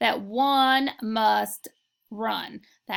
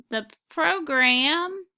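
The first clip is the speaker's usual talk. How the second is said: slow dictation reading; much slower than usual. Shown as a demonstration. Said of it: "I'm going to read the next sentence".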